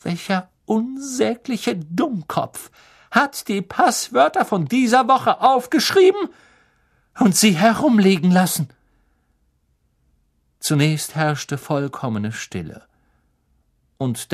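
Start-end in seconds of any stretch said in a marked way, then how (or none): 0.0-8.7 trembling fury. A narrator's reading. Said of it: "sagte sie mit zitternder Stimme".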